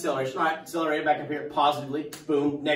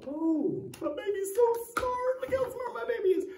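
high, gushing proud-mom voice; doting mom; 5 dB quieter than usual. Pretend. Teacher playing a mom doting on her smart kid, high gushing coo. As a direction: coo like a proud mother over her baby. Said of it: "And she would go".

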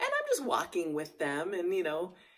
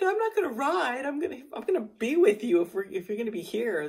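high, loud, quick gushing; excited girlfriend voice; 7 semitones higher, 6 dB louder than usual. Retold or imitated. Girlfriend at the ranch changing plans, high excited gush. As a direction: voice a friend too thrilled to ride. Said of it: "Susanna's like".

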